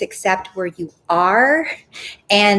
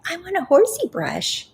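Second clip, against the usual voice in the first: put-on much higher, a light little-girl voice; childlike wish; 9 semitones higher than usual. Vlogger voicing her own wish as a child, much higher. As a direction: ask for it like an eager kid.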